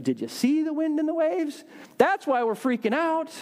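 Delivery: high, incredulous mock protest; exasperated retort. Preacher answers Jesus back about the storm, high exasperated protest. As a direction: throw it back in disbelief, pitch way up.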